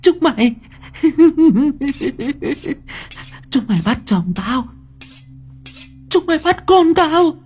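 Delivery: deranged high-pitched cursing; frenzied, vengeful shrieking that builds; teeth-gritted rage. Acted. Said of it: "Cô Hoa nghiến răng nghiến lợi".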